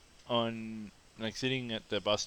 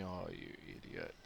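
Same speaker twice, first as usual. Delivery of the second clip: inner thought; 4 semitones lower, 14 dB quieter than usual. Retold or imitated. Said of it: "I'm just thinking".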